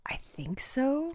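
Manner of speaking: breathy whispered agreement. Real, not acted. Agreeing with her co-host in a whisper. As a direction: whisper it, barely voiced.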